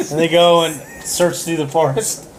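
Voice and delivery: ordinary delivery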